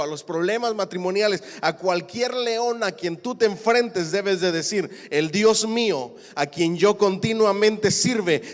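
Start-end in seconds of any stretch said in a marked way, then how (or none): 1.6-8.5 loud, rising preacher's cry; fiery preaching. Acted. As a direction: preach it out to the whole church. Preaching at full force.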